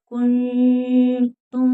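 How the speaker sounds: लकुंतुम slow, hidden nasal n; tajweed demo. Shown as a demonstration. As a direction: chant लकुंतुम with ikhfa. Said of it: "इख्फा होगा".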